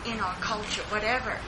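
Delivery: ordinary delivery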